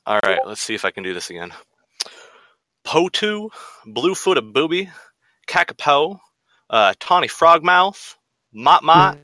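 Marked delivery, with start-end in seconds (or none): 2.9-9.2 put-on southern accent. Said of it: "in the same accent".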